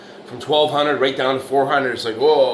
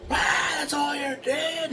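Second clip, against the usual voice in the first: retold psychotic scream; slower than usual. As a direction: imitate the crazed foreman, pitched way up. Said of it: "he's screaming at the guy".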